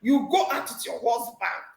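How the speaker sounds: scolding church mommy. Retold or imitated. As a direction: imitate a church mother scolding a young woman. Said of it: "She scolded me like a child".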